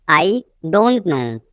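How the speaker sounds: teacher's slow split before "don't"; slow repeat. Shown as a demonstration. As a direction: pause after "I". Teacher repeats sentence slowly.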